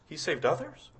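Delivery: questioning. Shown as a demonstration. Preacher questioning the mockers' words, softly doubtful. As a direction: question the taunt gently. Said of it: "Did he?".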